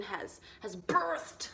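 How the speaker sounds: BIRTHED drawn out slowly with heavy weight; slow, overdone emphasis on BIRTHED; oddly dramatic delivery. Real, not acted. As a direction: stretch out BIRTHED like a grand pronouncement. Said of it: "Why did I say it like that?".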